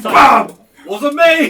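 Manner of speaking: loud playful cry; shouting. Pretend. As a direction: yell it, then deny. Acting out the ambush.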